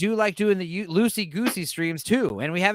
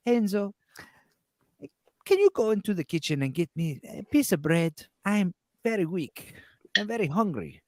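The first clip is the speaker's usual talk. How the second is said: frail Italian grandmother; 4 dB quieter than usual. Retold or imitated. Grandmother begging Enzo for bread, in frail Italian voice. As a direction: plead feebly in an Italian accent. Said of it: "And then she would be like".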